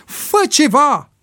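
high, drawn-out yell; desperate yell. A narrator's reading. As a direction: yell it slowly at a pitch of urgency. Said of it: "urlă Ricky". (urlet disperat, prelung și înalt)

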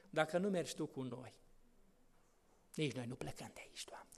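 hushed, fast whispered prayer; whispered plea. Acted. Moses begs God not to leave, in a whisper. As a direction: breathe it as a timid plea.

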